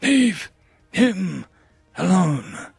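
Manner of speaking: slow, rasping menace; raspy voice. Acted. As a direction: drag each word out in a rasp. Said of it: "The rasping voice".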